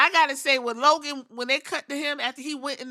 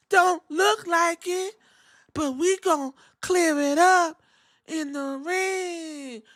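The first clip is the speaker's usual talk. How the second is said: slower, higher put-on creepy wrestler voice, cracking at the end; mock Bo Dallas accent; 6 semitones higher, slower than usual. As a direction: ham up a spooky wrestler promo. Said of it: "Bo Dallas and his accent".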